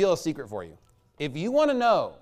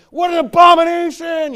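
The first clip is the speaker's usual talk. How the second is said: hammed-up shriek, very high and loud; mock scream; 13 dB louder than usual. Mocking himself shrieking at ranch bottles in a store, very high and loud. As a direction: scream it like a ranch-hating fanatic. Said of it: "start screaming, like".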